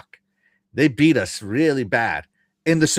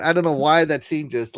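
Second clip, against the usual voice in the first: ordinary talk, nothing marked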